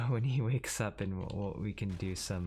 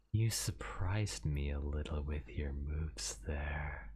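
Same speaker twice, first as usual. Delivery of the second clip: soft slow whispery yandere; breathy creepy obsessed whisper; 5 semitones lower, 4 dB quieter than usual. Acted. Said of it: "I'll try this in yandere".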